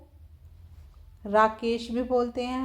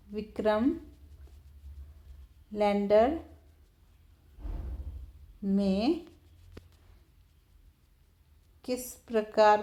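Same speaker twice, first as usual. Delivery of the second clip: each word set apart by long pauses, slow and deliberate; धीरे-धीरे, हर शब्द के बाद रुककर बोलना; slow dictation. Shown as a demonstration. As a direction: read it out word by word so children can write it down. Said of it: "repeats the question word by word".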